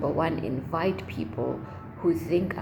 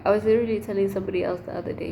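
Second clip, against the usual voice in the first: rapid nonstop chatter; 2 semitones higher, 4 dB louder, much faster than usual. Real, not acted. Rapid excited retelling.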